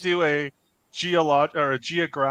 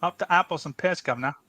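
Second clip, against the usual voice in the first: higher put-on Cockney rhyming slang; Cockney accent; 3 semitones higher than usual. Shown as a demonstration. Host answering the call to hear that Cockney, Cockney accent. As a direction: perform the phrase as a Cockney. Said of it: "Let's hear that Cockney".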